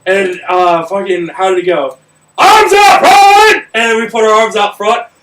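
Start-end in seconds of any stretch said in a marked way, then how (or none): 2.4-3.6 loud, high barked command; drill sergeant yell. Retold or imitated. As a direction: bark it at the whole warehouse. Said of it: "I had the loudest voice".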